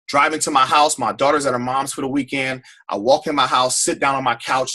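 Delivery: ordinary talk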